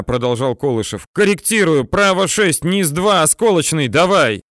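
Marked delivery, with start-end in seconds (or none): 1.1-4.4 high, raised military callout; urgent correction. A narrator's reading. Officer correcting tank fire over intercom, high urgent call.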